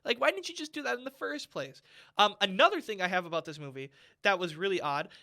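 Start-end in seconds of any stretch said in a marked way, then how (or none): 0.1-1.8 much higher, incredulous questioning; exasperated. Retold or imitated. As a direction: throw up your hands at the screen. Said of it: "I'm like".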